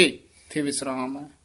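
usual speaking voice

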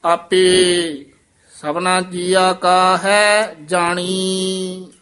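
measured chanting recitation of Gurbani; scripture recitation. Shown as a demonstration. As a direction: recite each phrase slowly and evenly.

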